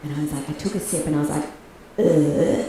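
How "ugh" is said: acted coffee burp; drawn-out fake belch. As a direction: let out a mock burp. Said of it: "coffee makes me burp".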